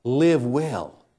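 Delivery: loud, punchy host opening; show intro announcer. Acted. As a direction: project a teaser for the show.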